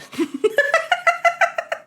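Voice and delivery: squeaky high cackle; giggling fit; high laugh burst. Real, not acted. Laugh after joke.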